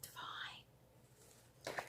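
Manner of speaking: a hushed, dreamy whisper of delight. Real, not acted. Stitcher admiring her finished piece, whispering. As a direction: breathe the word like a secret treat.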